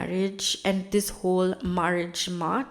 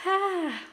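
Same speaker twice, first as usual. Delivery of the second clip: high, falling sigh; 11 semitones higher than usual. Real, not acted. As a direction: let out a sighing "ah". Sighs before moving on in recap.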